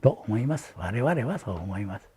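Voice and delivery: ordinary delivery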